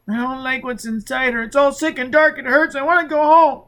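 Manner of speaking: put-on high, strained child's voice; a scared little boy pleading; frightened child.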